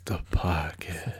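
low, breathy whispered sign-off; deep whisper. Acted.

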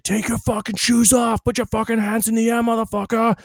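high, strained mock yell; imitating barked orders. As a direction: bark orders like airport security. Imitating LAX security staff barking orders.

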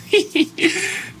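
high, helpless giggle; real burst of laughter. Real, not acted. Cracks up at her own joke.

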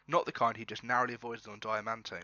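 ordinary talk, nothing marked

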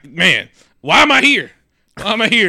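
exasperated lament. Acted. Acting out envious exasperated realization.

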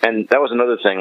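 ordinary talk, nothing marked

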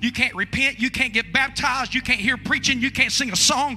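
loud rising sermon delivery; fiery preaching. Real, not acted. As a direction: preach it hard and building. Preaching at full force.

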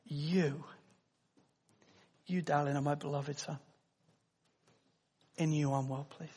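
soft, hushed blessing to a beloved son; tender father's voice. Acted. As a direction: speak it softly with a father's pride. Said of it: "the Father speaks".

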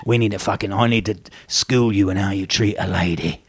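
low, menacing East End gangster voice; lightly voiced Cockney gangster having a word; Ray Winston gangster. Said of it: "Ray Winston on them".

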